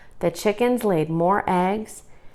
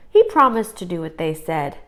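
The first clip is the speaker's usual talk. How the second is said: usual speaking voice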